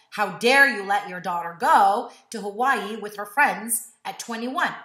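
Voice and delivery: loud indignant gossip; mock judgment. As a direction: scold like nosy neighbours. Imagined people judging mom.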